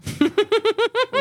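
shrieking laugh; high burst of laughter. Real, not acted. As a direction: crack up high. Reacting to headline.

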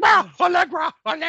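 very high, loud mock swoon; hammed-up fan ecstasy; gushing fan impression. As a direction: cry out like a besotted fan. Imitating the gushing guy in the crowd.

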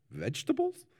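put-on high, nervous foster kid. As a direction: guess timidly in a very high, questioning voice. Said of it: "he says nervously".

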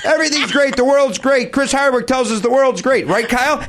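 high-pitched ranting takedown; mock hostility. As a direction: build a comic tirade, climbing in pitch as it goes. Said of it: "been subjected to this kind of hostility".

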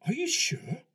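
loud, high-pitched disbelieving question; doubting colonel. Retold or imitated. Colonel doubts the interviewee's story, incredulous. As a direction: challenge him, pitched well up.

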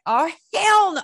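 high, loud burst of outrage; indignant outburst. Retold or imitated. Bandleader reacts to a blocked studio entrance, loud and indignant. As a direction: let the outrage leap out, loud.

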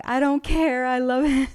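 retelling her own thrilled reply; gushing. Retold or imitated. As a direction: replay your own delighted gush. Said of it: "And I'm like".

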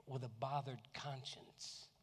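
usual speaking voice

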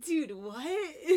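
high, loud mock disbelief; hammed-up bewildered protest; incredulous. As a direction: echo a friend's baffled outrage. Voicing heroine's disbelief.

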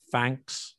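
"thanks" said with an F at the start; Cockney "thanks", fronted to an F sound. Shown as a demonstration. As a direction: begin "thanks" with F instead of TH. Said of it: "It could be an F at the beginning".

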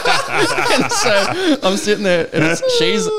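high, slow, laughing through words; can barely get it out for laughing. Real, not acted. Telling friends the preschool story, laughing hard.

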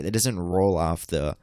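usual speaking voice

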